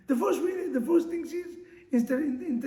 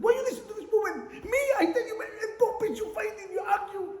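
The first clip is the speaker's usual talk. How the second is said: a hammed-up wife shouting at her husband with a laugh; laughing mock rant; 10 semitones higher, faster than usual. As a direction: ham up the jealous wife, laughing as you yell. Wife's complaint acted out.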